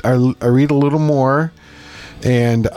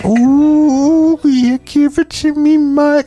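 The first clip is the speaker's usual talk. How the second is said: much higher, louder swooning moan; 14 semitones higher, 4 dB louder, much slower than usual. Retold or imitated. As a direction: moan it like a granny in ecstasy. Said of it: "She's like".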